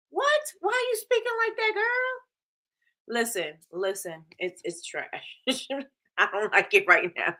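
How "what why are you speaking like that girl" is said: very high squeaky questioning; mock disbelief. Vlogger questions the actress's way of talking, high squeaky disbelief. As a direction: shoot up to a quick, baffled squeal.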